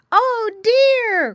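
very high, loud wail; crying out. A narrator's reading. Little pigs hear brother is lost, crying out. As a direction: cry out in piglet dismay. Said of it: "cried all the little pigs".

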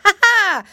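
high, loud monkey "ha ha"; mock laugh. A narrator's reading. Monkey laughing at the piggy boy, high acted laugh. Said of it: "laughed the monkey again".

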